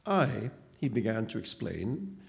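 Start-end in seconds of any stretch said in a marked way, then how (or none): none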